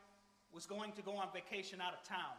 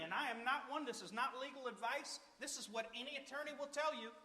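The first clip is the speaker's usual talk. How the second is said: ordinary delivery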